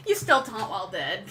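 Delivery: talking through laughter; amused, laugh running through the words. Real, not acted. Laughing at taunt while dead.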